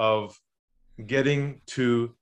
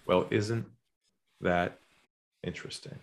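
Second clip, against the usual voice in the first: low, soft and calm, trailing to a breath; hushed, calm reply to a pushy caller; lowered voice; 6 semitones lower, 7 dB quieter than usual. Retold or imitated. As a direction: say it low and unhurried, almost whispered at the end. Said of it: "I lowered my voice".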